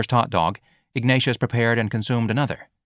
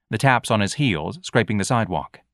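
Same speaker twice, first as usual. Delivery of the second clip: ordinary delivery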